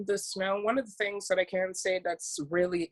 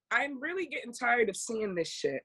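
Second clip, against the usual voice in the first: ordinary talk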